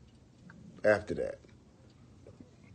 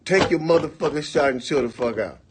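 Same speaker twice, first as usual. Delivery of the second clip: louder, higher, fed up; angry; 5 semitones higher, 9 dB louder than usual. Retold or imitated. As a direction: snap at him to stop. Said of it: "So I'm like".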